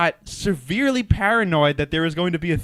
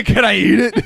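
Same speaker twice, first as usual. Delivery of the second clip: loud, higher monster caricature; mock monster mom; 5 semitones higher, 9 dB louder than usual. Echoing co-host's monster mom joke, loud mock monster. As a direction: laughingly belt out the monster's question. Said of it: "the mom monster's like".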